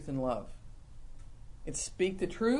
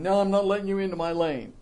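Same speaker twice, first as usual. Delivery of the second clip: louder, snippy refusal; snippy driver; 5 dB louder, faster than usual. Retold or imitated. Driver refusing another car the lane, snippy and louder. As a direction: bark it at the other driver. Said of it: "Even driving".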